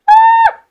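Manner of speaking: sudden high, loud shriek; startled scream. Real, not acted. Streamer frightened by the game, startled scream. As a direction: scream as if jumped. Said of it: "Me ha asustado".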